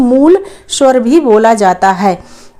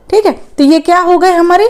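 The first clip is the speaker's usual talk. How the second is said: normal talking voice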